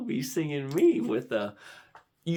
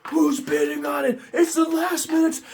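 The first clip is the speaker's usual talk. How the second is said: high, alarmed acted outburst; mock panic; 14 semitones higher, 4 dB louder than usual. Bidder seeing a last-minute rival, mock panic.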